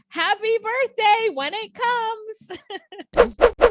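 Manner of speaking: high, slow, sing-song wish; high drawn-out birthday wish; playful sing-song. Pretend. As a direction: croon it sweetly and slowly. Playful sign-off.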